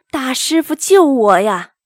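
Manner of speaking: frightened appeal, lightly acted; mildly fearful plea. A narrator's reading. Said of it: "颤抖着说". (略带惊恐地高声哀求)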